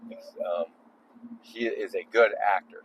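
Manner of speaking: ordinary talk, nothing marked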